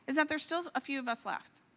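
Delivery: ordinary talk, nothing marked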